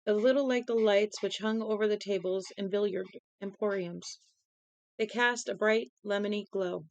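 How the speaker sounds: reading a novel aloud; calm storyteller read; story narration. Acted.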